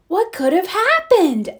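put-on high-pitched puzzled little boy. A narrator's reading. Little boy asking grandmother about the yarn, high voice. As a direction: lift the pitch for a child's question. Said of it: "asked the little boy".

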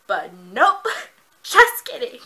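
loud laughing "just kidding", slow; tease, then laugh it off. Real, not acted. Teasing joke with laugh.

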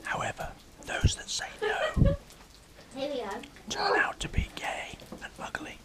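secret stage whisper. Real, not acted. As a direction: whisper it as a tease. Teasing aside to camera.

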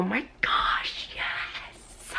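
slow, breath-only whispered squeal; excited whisper. Real, not acted. Collector thrilled while everybody's sleeping, excited whisper. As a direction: whisper the thrill so nobody wakes.

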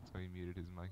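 normal talking voice

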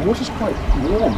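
speaking up over machine noise. Real, not acted. Touring noisy still house.

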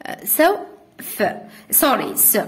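normal talking voice

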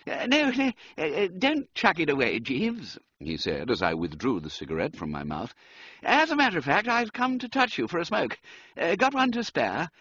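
high, stammering toff; a frazzled Bertie cadging a smoke; flustered young gentleman. Acted. Wooster begs a cigarette.

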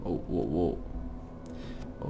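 hushed surprise. Real, not acted. Surprise at opponent's play.

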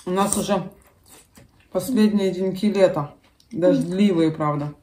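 speaking between bites; chewing while talking. Real, not acted. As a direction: mouth partly full. Seen 1: she bites bread, then spoons soup, chewing between phrases.

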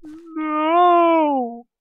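very high, loud wail of despair; hammed-up shriek of rejection; anguished mock cry. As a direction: cry out like your heart just broke. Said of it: "I will be like".

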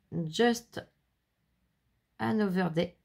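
slow, careful English read with a French accent; a French speaker reading an English title slowly. Real, not acted. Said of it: "je m'excuse pour mon anglais".

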